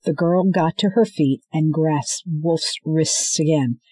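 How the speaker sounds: ordinary delivery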